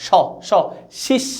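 স as "sho", শিস as "shish"; separate, hushing "sh" in স, স, শিস; "sh" sound demo. Shown as a demonstration. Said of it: "স এর মতো উচ্চারণ হয়". (স আর শিস-এ স্পষ্ট "শ" ধ্বনি)

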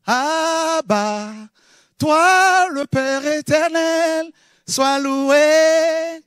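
high, loud, slow exalted prayer; fervent praise. Real, not acted. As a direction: lift the voice in worship. Preacher bursts into praise prayer.

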